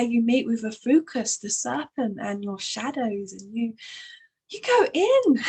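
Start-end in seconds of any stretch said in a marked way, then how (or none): 4.5-5.4 high, loud, laughing delivery; amused laughing. Real, not acted. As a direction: let laughter burst through the words. Amused, laughing about going inward.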